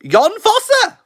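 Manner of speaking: high, loud cry of the winner's name; excited shout. Real, not acted. Hearing the Nobel winner announced, excited high shout. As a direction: burst out the name in delight.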